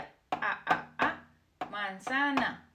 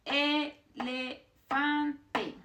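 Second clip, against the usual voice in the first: "Elefante" very slowly as e-le-fan-te, higher; syllable by syllable; 4 semitones higher, much slower than usual. Shown as a demonstration. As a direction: break "Elefante" into long, separate syllables. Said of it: "always the same".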